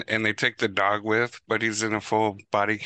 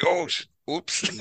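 slow mock oops; 8 semitones higher than usual. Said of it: "He's like".

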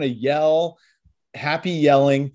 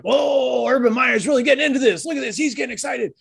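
much higher, gushing sportscaster voice; mock announcer; 9 semitones higher than usual. Host mocking the game announcer, high gushing commentary. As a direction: play a TV announcer hyping every first down. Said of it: "the announcer's like".

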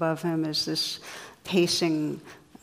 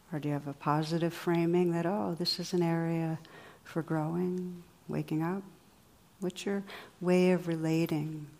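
guided meditation; 4 dB quieter than usual. Acted. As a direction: guide them gently inward. Guided reflection, eyes closed.

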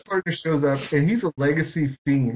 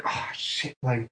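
softer, whispered "oh, shit" to himself; whispered retold inner thought; hushed aside; 4 semitones lower, 8 dB quieter, much slower than usual. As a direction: breathe it like a private realisation.

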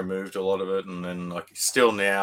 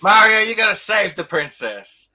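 higher, louder put-on designer voice; hammed-up jaded game boss; mock game designer; 8 semitones higher, 10 dB louder than usual. Friend to friends imitating a game maker planning Mario, hammed-up. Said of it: "He's like".

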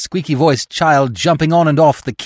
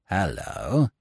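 lower, smug voice for Steggles; a sly cad greeting an old acquaintance, pitched lower; lower, oily rival's voice; 9 semitones lower, 7 dB quieter than usual. A narrator's reading. Steggles voiced in narrated story.

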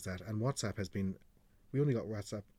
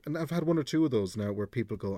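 ordinary talk

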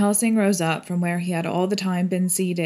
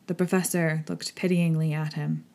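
plain reading voice

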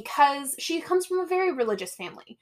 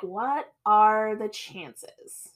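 very slow, drawn-out question; pondering girlfriend; much slower than usual. Retold or imitated. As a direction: stretch out each word as if weighing it up. Girlfriend pondering, in retold scene.

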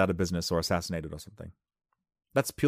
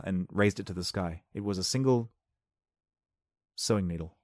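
ordinary talk, nothing marked

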